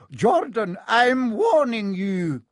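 high, slow, drawn-out threat; stern warning. A narrator's reading. Professor warning the biased commentator, stern and slow. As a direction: warn the student slowly and firmly.

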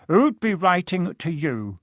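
sneering. A narrator's reading. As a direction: draw it out with contempt. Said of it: "sneered Uncle Vernon".

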